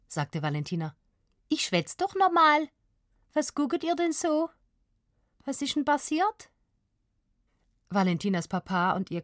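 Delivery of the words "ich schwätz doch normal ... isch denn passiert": put-on high, puzzled Swabian child voice; Swabian girl. A narrator's reading. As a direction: protest innocently in Swabian dialect. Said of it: "auf einmal Schwäbisch". (verwundertes Mädchen auf Schwäbisch)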